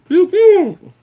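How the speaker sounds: pew pew, high laser shots; squeaky blaster noises; high pew pew sound effect. Acted.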